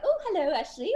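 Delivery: put-on high, quick childlike hello; excited juvenile voice. Alien visitor greets the woman, imitated excited juvenile voice. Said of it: "it sounded like a juvenile voice that was so excited".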